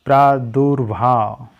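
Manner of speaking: प्रादुर्भाव slowly, praa-dur-bhaav; drawn out प्रादुर्भाव; slow careful form. Shown as a demonstration. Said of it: "ये हो जाएगा प्रादुर्भाव". (प्रादुर्भाव धीरे, साफ़)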